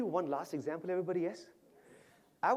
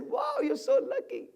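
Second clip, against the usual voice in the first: high, loud gushing wow; big high-pitched cheering; excited admiration; 13 semitones higher, 8 dB louder than usual. Retold or imitated. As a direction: gush with delight for her. Cheering Padma about her husband.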